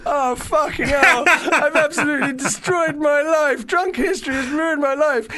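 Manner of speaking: high, laughing, slowed despair; laughing panic. Retold or imitated. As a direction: wail it through laughter. Drunk morning panic retold.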